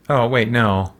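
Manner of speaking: normal talking voice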